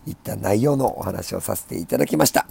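ordinary talk, nothing marked

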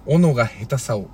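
put-on slow low wise old coach; Anzai-sensei impression. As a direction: speak like a calm old mentor. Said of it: "安西先生。".

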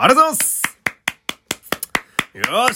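exuberant high, loud thank-you; excited thanks. Real, not acted. Radio host thanks listeners for a ranking, excited. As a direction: burst out with gleeful thanks. Celebrating second place in the ranking.

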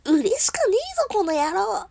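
high, squeaky little-reindeer voice; Chopper impression. Streamer imitating Chopper, very high and squeaky. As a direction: yelp it high like bashful Chopper. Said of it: "似てない".

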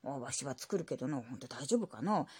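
lightly voiced old grandpa; grumbly old grandpa reply; old man voice. Grandpa character voice.

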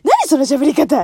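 loud exasperated shout; mother's angry yell. Retold or imitated. Mother yelling at the Minnie voice. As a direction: bark it out, fed up. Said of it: "って言って".

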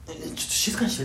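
imitating a stranger's whisper; mock whispered request to keep quiet; whispered hush. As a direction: breathe it out like a shushing neighbour. Stranger hushing them, retold.

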